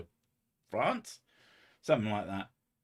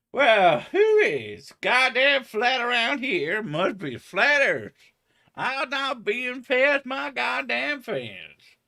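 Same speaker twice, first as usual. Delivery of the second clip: exaggerated Kansas accent, much higher, loud and slow; mock Kansas accent of a grumpy flat earther; hammed-up Kansas accent, slow and high; 13 semitones higher, 7 dB louder, slower than usual. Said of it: "Kansasian, uh, accent".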